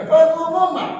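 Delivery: "Pengumuman" lebih keras dan tinggi. Shown as a demonstration. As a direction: serukan seperti juru umum. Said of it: "Bukan pengumuman, ya". (seruan "Pengumuman" yang tinggi dan keras)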